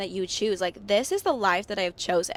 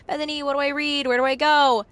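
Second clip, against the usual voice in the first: higher-pitched mock listener plea; eager listener asking; 5 semitones higher, 5 dB louder than usual. Listeners begging the host for book recommendations, mock pleading. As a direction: imitate a pestering follower, pitched up.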